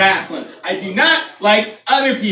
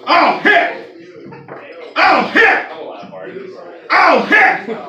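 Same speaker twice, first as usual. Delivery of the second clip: hammed-up fan shouting at wrestling; mock angry yell; 5 semitones higher, much slower than usual. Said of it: "He's standing there going".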